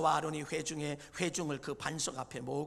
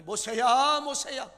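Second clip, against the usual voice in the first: God calling loudly across distance; a ringing divine call; 5 semitones higher, 12 dB louder, slower than usual. Acted. God calling Moses by name, loud and ringing.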